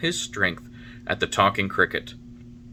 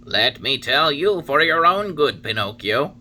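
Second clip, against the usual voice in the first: higher, louder solemn cricket warning; Talking Cricket warning; 6 semitones higher, 6 dB louder than usual. A narrator's reading. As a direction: preach it like a wise old insect. Cricket advises Pinocchio.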